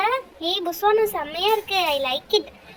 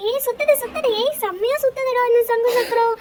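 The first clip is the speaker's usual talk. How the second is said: excited; 5 semitones higher than usual. Acted. Flower wheel starts spinning.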